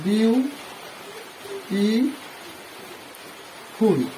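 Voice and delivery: "বিউ", "টি", "ফুল" as three separate beats, long gaps between; syllable by syllable. Shown as a demonstration. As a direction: break it into "বিউ ... টি ... ফুল" for learners. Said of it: "তিনবারে উচ্চারণ করছি". ("বিউ টি ফুল" তিন ভাগে থেমে থেমে)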